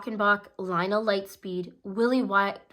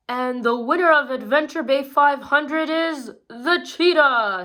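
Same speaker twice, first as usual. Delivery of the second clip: loud, higher winner announcement; announcer; 5 semitones higher, 6 dB louder than usual. A narrator's reading. Ron the announcer naming the race winner, loud. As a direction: boom out the winner like a commentator. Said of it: "said Ron".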